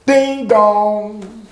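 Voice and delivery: imitated doorbell. Doorbell rings while he is in living room, imitated chime. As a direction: mimic a doorbell with held notes.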